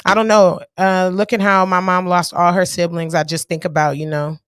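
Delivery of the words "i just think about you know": usual speaking voice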